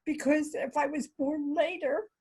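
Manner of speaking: choked up, fighting back tears; tearing up. Real, not acted. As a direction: voice rising high with tears. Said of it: "I'm sorry, I'm so emotional".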